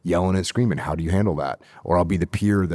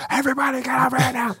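shrill high-pitched mock scream, rushed out; panicked yelling; faster than usual. Young SEAL leader caught on the voice recorder, panicked yelling. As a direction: imitate a freaked-out leader screaming orders, high and fast. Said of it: "freaking out, yelling and screaming".

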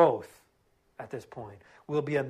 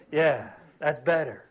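ordinary talk

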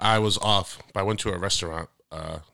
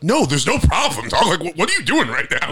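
loud, higher incredulous retort; retold challenge; 8 semitones higher, 6 dB louder than usual. To the guy asking for a problem, a loud retort. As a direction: push back hard on the guy.

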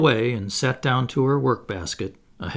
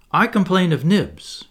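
higher, louder eager complaint; prompt cry; 6 semitones higher, 6 dB louder than usual. A narrator's reading. Slightly seizing his chance to tell on Nibs, crying out. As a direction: pipe up at once with a grievance. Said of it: "he cried promptly".

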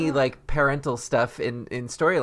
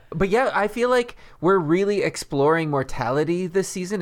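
ordinary talk, nothing marked

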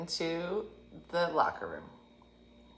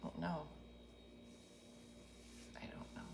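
softer, timid mumble; meek; 2 semitones lower, 23 dB quieter, faster than usual. Retold or imitated. Scared tourist answering the pushy guide, meek mumble. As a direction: shrink away from him.

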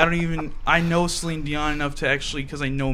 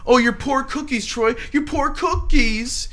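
mock pity; 9 semitones higher than usual. Mocking pity in rap.

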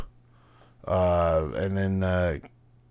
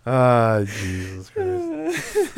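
drawn-out exasperation; much slower than usual. Real, not acted. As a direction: stretch it out, fed up and amused. Exasperated after laughing.

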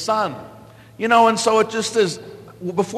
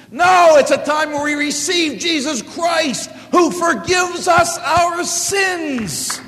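higher, louder, very slow; preaching shout; 11 semitones higher, 5 dB louder, slower than usual. Real, not acted. Preacher correcting cultural Christians, shouting higher and louder. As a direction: proclaim it to the whole church.

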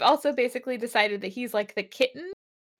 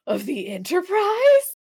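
higher, louder and slow, a starstruck rising question; mock thrilled; 6 semitones higher, 7 dB louder than usual. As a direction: play it like a fanboy meeting his hero. Kirk thrilled to meet rival.

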